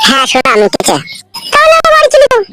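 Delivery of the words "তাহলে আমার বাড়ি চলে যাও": high falsetto woman's voice; young woman voice. Acted. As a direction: squeak it up as the girlfriend. Girlfriend voice.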